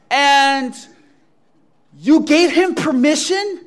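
high, loud, questioning outrage; loud incredulity. Acted. Imagined Peter challenges Jesus over permission, loudly incredulous. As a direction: build from a drawn-out lead word to aghast question.

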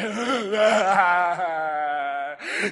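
long hammed-up wail; mock wailing. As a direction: bawl in exaggerated despair. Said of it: "And he's like".

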